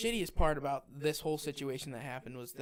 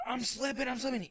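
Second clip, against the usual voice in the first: high, panicky mimicry; mock-frightened climber losing hold; imitating panic; 8 semitones higher than usual. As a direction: act out his freak-out in a much higher voice. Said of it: "He's freaking out".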